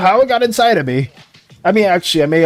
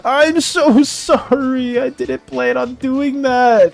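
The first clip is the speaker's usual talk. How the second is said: slow, higher, pleading apology; panicked apology; 7 semitones higher, slower than usual. Real, not acted. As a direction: beg forgiveness, drawn out. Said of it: "I was panicking".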